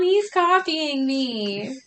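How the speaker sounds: high, slow whine to mom; whiny tattling little sibling. Pretend. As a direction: tattle on him like a little kid.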